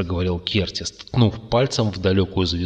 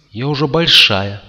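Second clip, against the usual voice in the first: offended; 5 semitones higher, 7 dB louder than usual. A narrator's reading. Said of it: "обиженно произнесла Злата".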